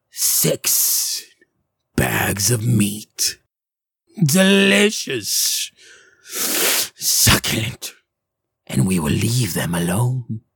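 slow, whispery, savouring hag; gasp, then relish each word slowly; euphoric hag. Acted. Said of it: "euphoric fashion".

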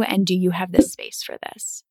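ordinary delivery

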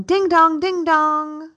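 sung high ding dong chime; imitated doorbell.

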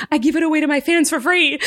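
nervous explanation. Retold or imitated. Performer explaining her merch to customs, high and nervous. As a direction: squeak it out defensively.

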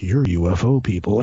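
low-voiced wary locals; suspicious congregation. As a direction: drop low, mimicking their silent judgment. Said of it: "they're looking at us like".

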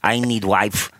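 broken English in a thick mock Russian accent; short halting phrases, hammed-up Russian accent; Vladimir Tarasenko. Podcaster joining the hockey star bit, comic Russian accent. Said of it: "That's Vladimir Tarasenko".

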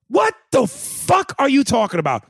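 can't believe this; incredulous. Real, not acted. Incredulous at listener.

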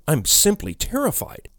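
high-pitched, frightened admission; terrified confession. A narrator's reading. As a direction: blurt it out, pitch shooting up. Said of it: "I managed to utter, speaking instinctively".

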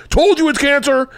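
high, told-you-so sneer; smug big brain. Retold or imitated. Big brain gloating at the mirror, high smug voice. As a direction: gloat that he was right. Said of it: "big brain's like".

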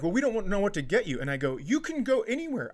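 ordinary talk

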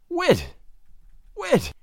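high, falling cry of his name; high-pitched, brief protest; startled woman's exclamation. A narrator's reading. Nan exclaims at his confession.

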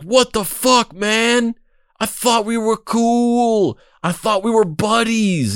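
high, slow, wounded whine; hurt Gerald. Retold or imitated. Gerald hurt that his journalist buddy betrayed him, high whine. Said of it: "Gerald's just like".

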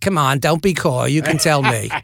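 coaxing mock-Cockney; imitated Cockney chumminess; Michael Caine. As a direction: wheedle gently in a rough London star impression. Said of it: "This is a terrible Michael Caine impression".

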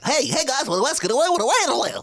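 put-on high, slow, chipper old-movie nerd voice; chipper 1950s nerd. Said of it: "talking like a nineteen fifties nerd from a movie".

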